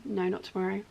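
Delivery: ordinary talk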